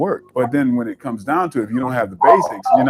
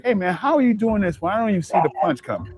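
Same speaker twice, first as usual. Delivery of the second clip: impressed friend; 8 semitones higher than usual. Retold or imitated. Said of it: "He was telling me".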